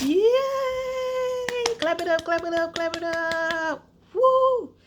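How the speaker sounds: high, loud cheering; celebrating. Real, not acted. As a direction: cheer it up like a party. Celebrating lowered dose.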